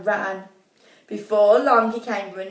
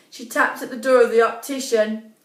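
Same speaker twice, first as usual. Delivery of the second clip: plain reading voice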